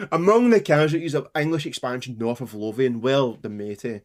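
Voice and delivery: usual speaking voice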